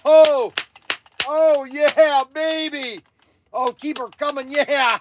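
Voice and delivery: high, delighted cries over a toy rifle; excited whoop. Real, not acted. Playing with the duck rifle.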